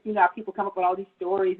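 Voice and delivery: ordinary talk, nothing marked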